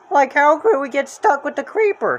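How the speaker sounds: high, scared slacker voice; cartoon stoner teen; Shaggy voice. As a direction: whine it like a nervous beatnik. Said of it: "Shaggy asked".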